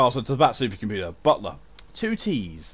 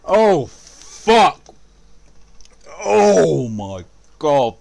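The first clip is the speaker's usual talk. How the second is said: pained yelling; 6 semitones higher, 7 dB louder, much slower than usual. Real, not acted. Cries out at game damage.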